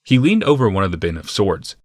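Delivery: ordinary delivery, nothing marked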